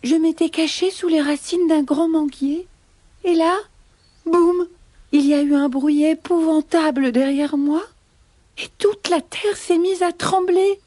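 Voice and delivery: high-pitched, frightened bunny account; trembling little rabbit. Acted. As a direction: recount the scare as a timid rabbit. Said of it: "répond Petit Lapin en tremblant".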